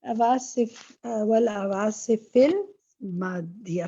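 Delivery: والعواصف المادية stretched out very slowly, every sound separate; very slow model reading. Shown as a demonstration. Teacher drills والعواصف المادية very slowly for the student. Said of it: "فی آ رہا ہے نا پیچھے".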